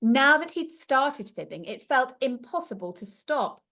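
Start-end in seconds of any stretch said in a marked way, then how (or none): none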